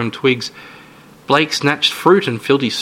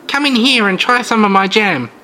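put-on sweet, creepy old lady; 9 semitones higher, 3 dB louder than usual.

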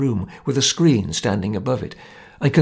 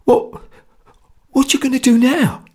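strained question; 12 semitones higher, 4 dB louder than usual. A narrator's reading. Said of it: "he asked with an effort".

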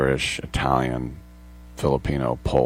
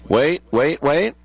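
higher, urgent call to stop the replay; urgent wait; 9 semitones higher, 3 dB louder, slower than usual. Real, not acted. Host stopping the replay, higher and urgent. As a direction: halt it, eager and high.

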